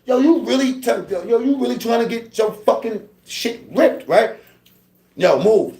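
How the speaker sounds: angry threat. Retold or imitated. Threatening Pearhead to move off the trays, high angry threat. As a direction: warn him he's about to get hurt.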